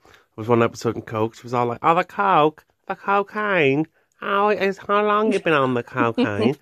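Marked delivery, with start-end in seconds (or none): 1.8-6.6 put-on high, drawn-out Northern Irish accent parody; hammed-up Northern Irish TV presenter accent; mock Northern Irish accent. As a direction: exaggerate the Northern Irish vowels, pitched up and slow. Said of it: "She's got the worst accent ever".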